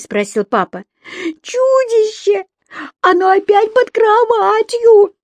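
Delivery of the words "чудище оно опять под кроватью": put-on very high, slow wailing child; crying again. A narrator's reading. Еля calling everyone back to her room, crying. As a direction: wail loudly, stretching the words in tears. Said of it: "Еля снова плакала".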